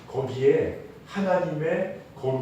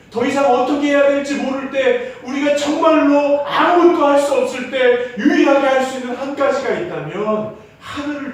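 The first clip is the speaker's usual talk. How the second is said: loud, high-pitched pulpit exhortation; impassioned preaching; 9 semitones higher, 8 dB louder than usual. Real, not acted. Preacher urging the congregation to look to God, impassioned. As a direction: preach it out with full force.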